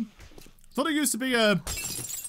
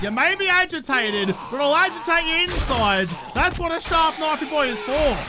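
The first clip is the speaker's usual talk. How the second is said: taunting; 6 semitones higher, 4 dB louder than usual. Real, not acted. Taunting zombie while stabbing.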